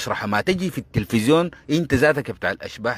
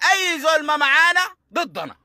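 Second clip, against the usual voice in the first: mimicked slogan.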